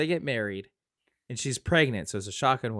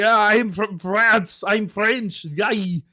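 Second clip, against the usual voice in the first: slow, higher, hammed-up French accent; worst French accent; 3 semitones higher, 4 dB louder, much slower than usual. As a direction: draw out a mock bad French accent. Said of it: "it's the worst French accent ever".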